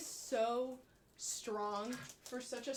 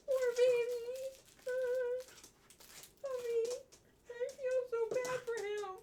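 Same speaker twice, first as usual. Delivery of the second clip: sympathetic coo; 11 semitones higher than usual. Real, not acted. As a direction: feel sorry for him. Dog's paws hurt.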